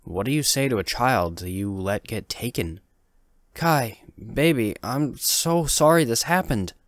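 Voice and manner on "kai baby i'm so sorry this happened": tender apology. Acted. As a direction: say sorry to your child gently. Mother apologizing to son in car.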